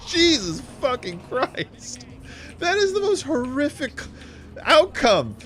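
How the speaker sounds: high, drawn-out appalled exclamation; appalled outcry. Acted. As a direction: exclaim in exaggerated horror. Mock outrage at the story's ending.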